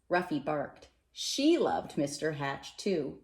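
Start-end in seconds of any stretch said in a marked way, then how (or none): none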